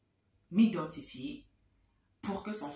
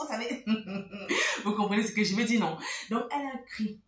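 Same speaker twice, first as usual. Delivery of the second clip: ordinary talk, nothing marked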